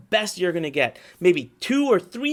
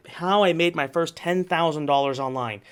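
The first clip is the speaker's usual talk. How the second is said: normal talking voice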